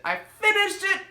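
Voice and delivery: high, triumphant cry of "finished". Real, not acted. Reader cheering to viewers that she finished the book, triumphant. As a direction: celebrate finishing it, pitch jumping up.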